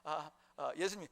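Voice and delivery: ordinary delivery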